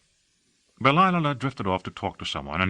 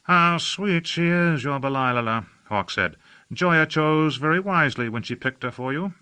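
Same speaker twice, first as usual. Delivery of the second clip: higher, airy, languid society gentleman; affected aristocrat; 7 semitones higher than usual. A narrator's reading. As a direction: gush about the city like a bored aesthete. Hawke praising Delilah.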